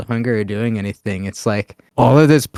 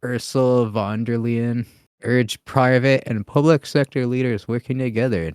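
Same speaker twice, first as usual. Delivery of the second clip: ordinary talk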